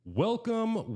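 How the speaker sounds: sung-out high welcome; big showman's hello. Acted. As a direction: stretch the first welcome up high. Podcast host's opening greeting.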